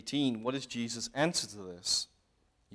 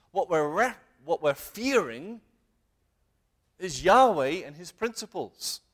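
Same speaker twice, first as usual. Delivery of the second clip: ordinary talk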